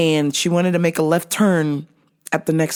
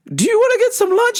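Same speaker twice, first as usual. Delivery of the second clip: chirpy mom; 13 semitones higher, faster than usual. Retold or imitated. Mom's offer.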